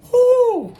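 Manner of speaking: shrill rising whoop of shock; high loud whoo. Retold or imitated. The game is thrown at him, a loud high whoo. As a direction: let out a sudden high whoo.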